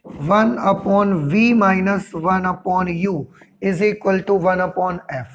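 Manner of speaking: formula read out very slowly, word by word; teacher dictating a formula slowly to the class; slow dictation. Shown as a demonstration. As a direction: spell it out slowly for students to copy. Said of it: "मैं आपको बता देता हूँ".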